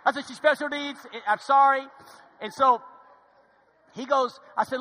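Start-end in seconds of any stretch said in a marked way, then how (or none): 0.3-1.9 high, loud flustered apology, retold; freaking out. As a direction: re-enact a panicked excuse. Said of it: "well, I'm freaking out".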